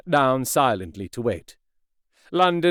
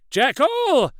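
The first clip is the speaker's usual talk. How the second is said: high, louder call of a name; loud cry; 12 semitones higher than usual. A narrator's reading. Utterson calling through the locked door, a loud cry. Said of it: "cried Utterson with a loud voice".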